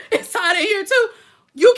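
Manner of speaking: laughing. Real, not acted. Laughing about heat.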